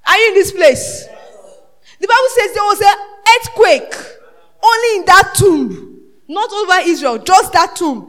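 loud, driving sermon delivery; fiery pulpit shout; preaching at full force. Acted. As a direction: hammer each phrase to the congregation.

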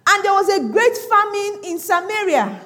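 high, loud scripture reading; preach at full force. Real, not acted. Preacher reads the famine verse to church, loud declamation.

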